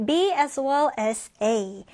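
B as well as A drawn out very slowly; very slow model phrase. Shown as a demonstration. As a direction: lay out each word slowly. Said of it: "다음 표현 같이 볼게요". (아주 천천히)

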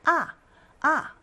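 아 falling fast from highest to lowest; fourth tone. Shown as a demonstration. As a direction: drop each 아 abruptly from the top. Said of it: "가장 높은 음에서 가장 낮은 음으로 빠르게".

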